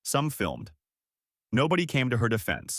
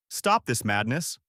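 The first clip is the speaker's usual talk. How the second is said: ordinary delivery, nothing marked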